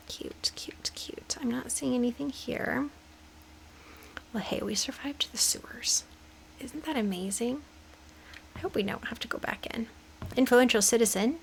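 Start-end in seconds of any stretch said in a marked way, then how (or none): 0.1-7.6 hushed, nervous whisper. Real, not acted. Sneaking around in scary game. 8.5-9.9 hushed, nervous whisper. Real, not acted. Nervous in scary game.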